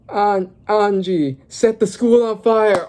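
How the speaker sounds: slow, high, weighty command; slow dramatic order. Acted. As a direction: drag out each word like a fateful order. Dramatic order read aloud.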